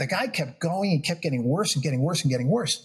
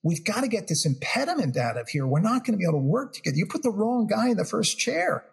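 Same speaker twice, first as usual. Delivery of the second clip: emphatic; 8 semitones higher than usual. Real, not acted. Insistent argument, pitch raised.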